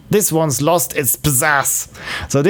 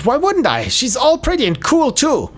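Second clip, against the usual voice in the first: defensive high voice; 7 semitones higher than usual. Acted.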